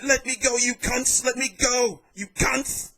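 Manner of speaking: imitated desperate yell of a fighter in a brawl; retold scream. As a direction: holler it while being held down. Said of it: "Then screaming".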